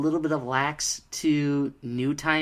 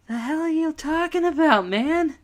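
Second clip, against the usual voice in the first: much higher, baffled and exasperated; incredulous high-pitched reaction; 14 semitones higher, 4 dB louder than usual. Retold or imitated. Reviewer reacting in disbelief to Percy's driver, high and baffled. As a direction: ask it like you can't believe the nonsense. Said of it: "I'm like".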